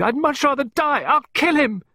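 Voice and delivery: fast, high-pitched hysterical outburst; screaming. A narrator's reading. Pippa refusing to return to her mother, screaming. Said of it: "she screamed".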